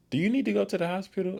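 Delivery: high-pitched, quick imitation of mom; quoting mom. Mom asks her in the car about the hospital, high imitated voice. Quoting mom's question on the drive.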